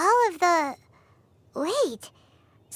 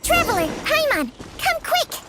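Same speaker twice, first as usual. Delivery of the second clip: urgent call, pitched higher on come quick; summoning friends in alarm; 7 semitones higher than usual. Acted. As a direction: call them over urgently from across the house. Calling others from another room.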